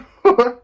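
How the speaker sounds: a sudden loud embarrassed cackle; loud high laugh. Real, not acted. Laughing at her own exposed outfit, loud. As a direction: burst of laughter.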